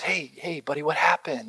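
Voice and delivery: put-on soft, quiet coaxing to a crying child; gentle soothing. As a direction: hush and calm a hurt little boy. Father soothing crying son.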